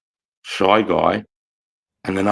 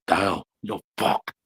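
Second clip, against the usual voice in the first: slow, softer menacing threat; 6 dB quieter than usual. Retold or imitated. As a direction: friend threatening Dale, slow and quiet. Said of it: "started going like this".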